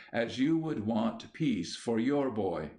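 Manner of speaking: ordinary delivery, nothing marked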